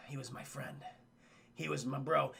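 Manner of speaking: low, soft, mournful; hushed mock-solemn mourning; subdued grief. Retelling Mac grieving his dead friend to his commander, subdued grief.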